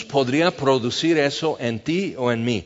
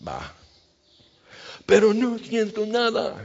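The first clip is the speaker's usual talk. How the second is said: higher put-on voice, shrugging it off; helpless admission; 7 semitones higher than usual. Believer answering the worship leader, higher and helpless. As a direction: play the believer who shrugs and says he feels nothing. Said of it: "Y tú dices".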